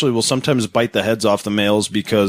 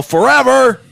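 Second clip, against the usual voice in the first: mock absolutism; 11 semitones higher, slower than usual. Host mocking the blogger's all-or-nothing logic, exaggerated. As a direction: stretch it out in mock grandeur.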